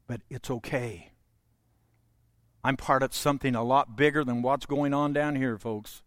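soft and low, easing them down; a quiet, soothing preacher; calm reassurance. Acted. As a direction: settle the room gently. Calming the congregation.

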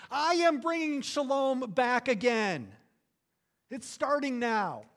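loud, high proclaiming shout; yelled proclamation. Retold or imitated. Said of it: "he is yelling to the entire creation".

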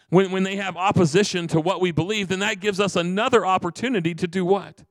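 high, loud building sermon; preaching. Acted. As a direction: drive the point home to the church. Preaching builds to full force.

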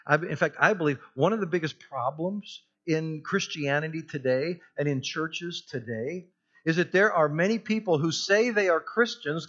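normal talking voice

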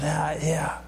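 soft, whispered acted musing; hushed voicing of someone's thoughts; inner thought. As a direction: murmur it as a private thought. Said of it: "In his mind, he said".

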